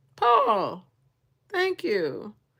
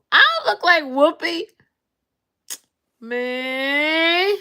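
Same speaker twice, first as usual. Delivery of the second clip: mock indignant protest; 7 semitones higher, 9 dB louder, slower than usual. Real, not acted. Viewer says she looks like Whoopi.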